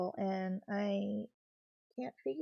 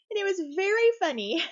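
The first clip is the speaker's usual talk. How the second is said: laughing; 9 semitones higher, 10 dB louder than usual. Real, not acted. Laughing recalling cat.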